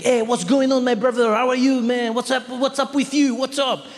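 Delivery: put-on high, chummy French-accented greeting; cool French guy. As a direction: greet like an overfriendly young Frenchman. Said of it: "very French, cool guy".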